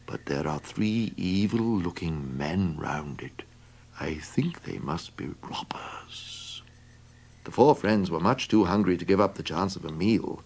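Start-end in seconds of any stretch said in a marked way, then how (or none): 0.1-6.6 hushed whisper. Acted. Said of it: "whispered the donkey".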